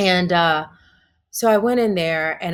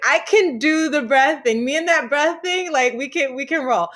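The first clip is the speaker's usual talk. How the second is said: higher and excited, she has found something that works; chuffed she can do it, pitched up high; 8 semitones higher than usual. Retold or imitated. Delighted at the breathing.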